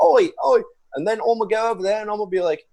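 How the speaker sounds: high, loud British caricature; mock British accent. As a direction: imitate non-Americans in exaggerated British. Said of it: "they're just like".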